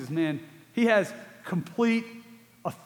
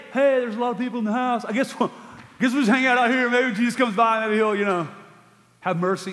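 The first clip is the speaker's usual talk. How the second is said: lazy shrug of a reply; 4 semitones higher, 3 dB louder, faster than usual. Retold or imitated. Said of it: "they don't say".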